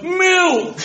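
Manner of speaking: Milk! yelled high and loud through laughter; loud aggressive shout. Retold or imitated. Tourist yelling Milk! at a French person, mock aggressive. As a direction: bark Milk! like a frustrated tourist. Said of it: "you might go".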